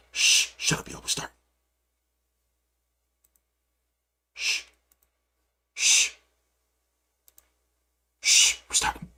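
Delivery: urgent hushed shh; whisper. Real, not acted. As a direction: hush the room before the call goes live. Hushing as the Zoom starts.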